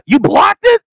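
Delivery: very high mock yell; imitating Jericho. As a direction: accuse an opponent in a high-pitched cry. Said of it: "audibly yelling him".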